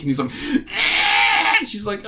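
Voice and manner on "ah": high, strangled effort yell; hammed-up strain on one long cry; mock straining cry. Villain struggling against Jean's powers, mock straining.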